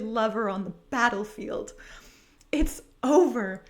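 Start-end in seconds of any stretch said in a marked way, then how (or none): 2.5-3.6 dramatic sob. Acted. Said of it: "she sobs".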